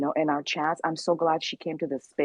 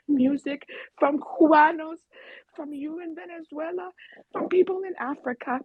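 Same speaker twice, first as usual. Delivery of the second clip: emotional; 6 semitones higher than usual. Real, not acted. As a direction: voice pitched up high with emotion, close to tears. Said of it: "I'm so emotional".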